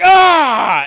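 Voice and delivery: loud, high battle yell; orc's roar. Acted. Game master voices the second orc, loud roar. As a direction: roar out a challenge. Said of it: "the, the orc is like".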